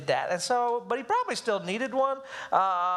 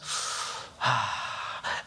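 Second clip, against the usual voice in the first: long, low breathy exhale; sigh of release; 11 semitones lower, 4 dB quieter than usual. Retold or imitated. Dad acts the moment the child's resistance breaks, long sigh. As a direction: let the breath go softly, as if tension breaks.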